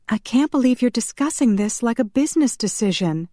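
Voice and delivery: high, indignant woman's voice; outraged yell. A narrator's reading. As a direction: protest in outrage without full volume. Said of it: "Shanna yelled".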